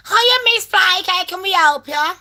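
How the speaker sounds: high, sing-song customer-service hello; call-centre greeter. Retold or imitated. Operator greets a customer on the phone, put-on high chirpy voice. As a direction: put on a high-pitched helpline voice.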